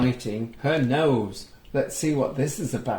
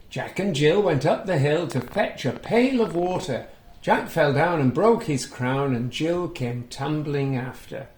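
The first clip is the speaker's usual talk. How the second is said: put-on reading to children; 2 semitones higher, 4 dB louder than usual. A narrator's reading. Reading a picture book aloud to young children, lively storytelling. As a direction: bring the story alive for little listeners. Recites nursery rhyme.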